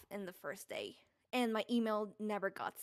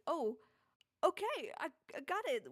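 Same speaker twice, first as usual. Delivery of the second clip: higher, lightly excited disbelief; retold excitement; 6 semitones higher than usual. Voice actor recalling getting the role, mildly excited. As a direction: replay the happy shock of getting the part. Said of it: "I got excited".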